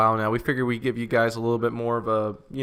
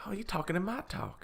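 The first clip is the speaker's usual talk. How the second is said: imitated Southern speaker; 9 semitones higher, 9 dB quieter than usual. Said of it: "It's like".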